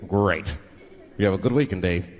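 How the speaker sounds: low, hearty businessman. Acted. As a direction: wrap up the deal in a low corporate voice. Same businessman, same call.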